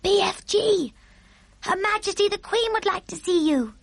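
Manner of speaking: put-on high child's call into the distance. A narrator's reading. Sophie calls giant from the window, high clear call. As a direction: summon someone across a garden, pitched high. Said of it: "called out".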